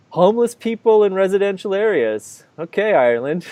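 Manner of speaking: sarcastically noting it to yourself, up high; dry sarcastic inner remark; sarcastic inner thought. Retold or imitated. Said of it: "thinking to myself".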